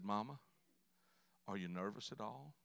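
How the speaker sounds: soft, low, careful question; sympathetic. Acted. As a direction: ease gently into a delicate question. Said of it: "Trying to be sympathetic".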